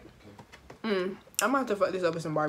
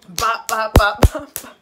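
loud, higher bops breaking into a laugh; laughing punch noises; 4 semitones higher, 12 dB louder, slower than usual. Retold or imitated. Storyteller re-enacting her punches, loud bops with laughter. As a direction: sound out each punch loudly, then crack up.

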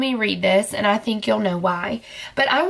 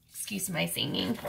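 sheepish aside; 7 semitones lower, 12 dB quieter than usual. Pretend. Embarrassed aside after singing.

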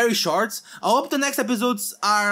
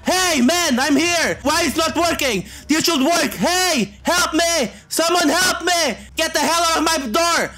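yelling at the intruder; 6 semitones higher than usual. Real, not acted. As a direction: shout at the man to look at you. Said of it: "I need to scream at".

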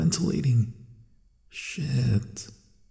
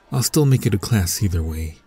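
ordinary talk, nothing marked

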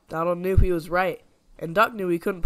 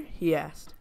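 usual speaking voice

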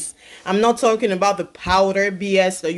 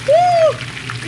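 high whoop; 4 dB louder than usual. Real, not acted. Excited cooking whoop.

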